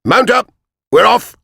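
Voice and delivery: high, loud command to ride; shouted order. Acted. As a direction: rouse everyone at once. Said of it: "shouted Soldier Hassan".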